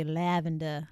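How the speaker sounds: "lavender" in an Australian accent, ending in an open "-duh"; Australian-style "lavender". Shown as a demonstration. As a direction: say "lavender" the Australian way, dropping the final r. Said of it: "if you wanna do Australian".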